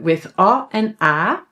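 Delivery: normal talking voice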